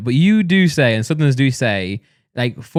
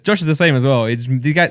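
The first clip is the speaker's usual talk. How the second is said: usual speaking voice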